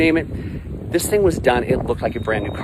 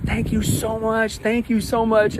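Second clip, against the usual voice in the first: put-on little boy's thanks; 9 semitones higher, slower than usual. Boy thanking customers, child voice.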